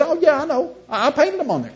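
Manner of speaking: high, offhand acted confession; mock casual admission. Trickster admits painting the cows, breezily unbothered.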